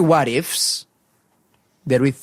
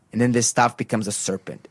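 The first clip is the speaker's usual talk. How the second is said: normal talking voice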